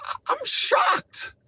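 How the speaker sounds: high, slow stunned disbelief; shocked. Real, not acted. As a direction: let the shock drag out. Reacting in disbelief.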